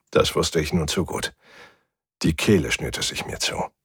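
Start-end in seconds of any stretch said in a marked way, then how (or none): none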